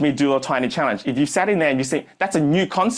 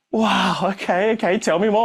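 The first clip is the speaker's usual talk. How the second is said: mock eager wow; 7 semitones higher than usual. Teller voicing Kaylee's eager reaction.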